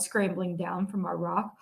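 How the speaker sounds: ordinary delivery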